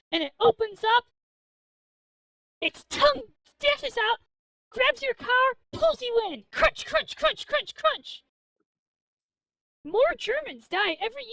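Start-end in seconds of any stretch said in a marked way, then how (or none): 0.1-6.4 excited storytelling. Acted. Wild clam story told with growing excitement. 6.5-8.2 crunching sound effect. Pretend. Clam eats the car.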